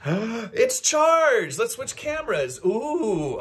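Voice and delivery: gasp, then a bright high burst of delight; surprised that the battery is already charged. Real, not acted. Vlogger discovers charged battery, gasps with delight.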